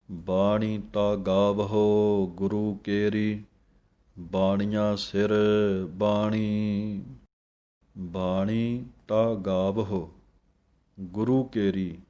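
slow, measured reading of Gurbani; scripture recitation. Shown as a demonstration. Teacher reciting Gurbani slowly for students to copy. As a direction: recite each phrase slowly and clearly.

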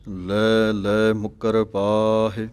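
slow, with ਮੁਕਰ said tight and firm. Shown as a demonstration. Teacher shows students a firm ਮੁਕਰ. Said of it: "ਮੁਕਰ ਪਾਠ ਪੋਲਾ ਨਹੀਂ ਪੜ੍ਹਨਾ".

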